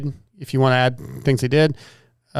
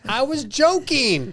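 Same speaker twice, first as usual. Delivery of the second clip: mock whiny voice; 10 semitones higher, slower than usual. Mocking friend's protest.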